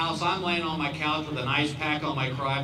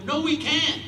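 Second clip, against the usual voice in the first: incredulous; 9 semitones higher than usual. Retold or imitated. Quarrel over the big dance.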